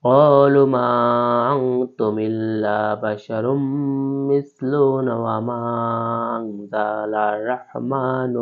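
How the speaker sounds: slow, melodic tilawat with long held vowels; Quran recitation. Shown as a demonstration. Teacher reciting the verse in full for students. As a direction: chant the verse like a qari.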